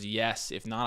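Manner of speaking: ordinary talk, nothing marked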